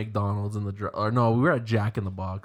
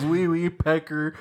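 squeaky voice; 5 semitones higher, slower than usual. Retold or imitated. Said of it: "He goes".

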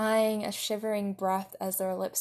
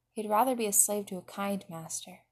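plain reading voice

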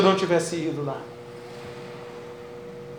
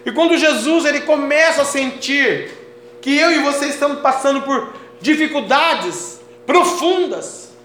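raised, high-pitched sermon cadence; full-force preaching; 7 semitones higher, 8 dB louder than usual. Real, not acted. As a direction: proclaim it fervently to the congregation. Prega com força total. (pregação fervorosa com voz elevada)

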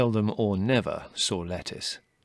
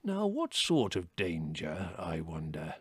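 slow elder, sinking low and soft at the end; musing old chief; 4 semitones lower, 5 dB quieter, slower than usual. A narrator's reading. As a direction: muse aloud, trailing into a near-whisper. Old chief muses at Fiver.

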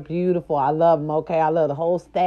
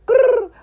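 playful high growl; 6 dB louder than usual. Real, not acted. Signature growl.

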